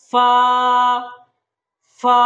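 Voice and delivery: ফা lengthened, lip against upper teeth; one-alif stretch. Shown as a demonstration. Teacher says try with me, ফা drawn out. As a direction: hold ফা as one steady syllable. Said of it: "এক আলিফ টানতে হবে".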